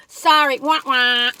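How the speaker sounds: loud sing-song wah wah; mock sad trombone. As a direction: sing a loud, playful letdown. Mock sad trombone after complaining.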